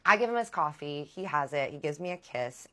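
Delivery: normal talking voice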